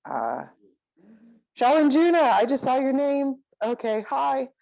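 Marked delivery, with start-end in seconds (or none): 1.5-4.5 pleasantly surprised. Real, not acted. Greets someone just noticed.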